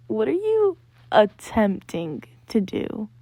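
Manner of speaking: slow, pointed imitation of a friend; dramatic drawn-out question. Imitating friend questioning the breathing, slow and dramatic. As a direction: stretch it out as if baffled and judging. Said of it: "quite literally said it like".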